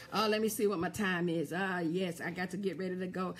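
ordinary talk

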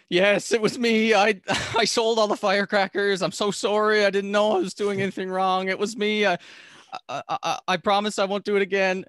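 put-on a scared kid spilling everything to the principal; panicked confession. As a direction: blurt it all out in a panic.

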